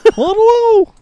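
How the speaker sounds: high, slow, whiny Ray Romano whine. Retold or imitated. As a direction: stretch it out like a sitcom dad. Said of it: "Jesus, Ray Romano".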